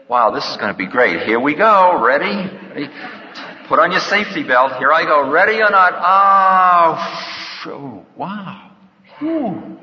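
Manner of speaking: buckle in and whoop lightly; a mock-excited soul heading into death; eager thrill. Dying soul eagerly launching into death.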